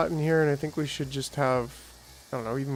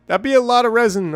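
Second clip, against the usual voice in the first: excited; 8 semitones higher, 10 dB louder than usual. Real, not acted. Excited by big resin haul.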